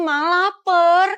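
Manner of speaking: long drawn-out pleading; hungry whine. Acted. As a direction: stretch it out slowly, pestering mom. Child begs mother for food.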